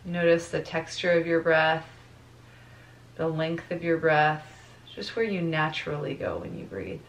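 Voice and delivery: soft meditation voice with long pauses; guided meditation. Real, not acted. Teacher guides class to notice their breath, guided meditation voice. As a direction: ease them into the breath, letting phrases fade softly.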